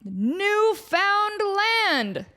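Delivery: lazily name it; drunk explorer. Retold or imitated. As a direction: drunk explorer brushing off the intern. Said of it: "the drunken explorers are like".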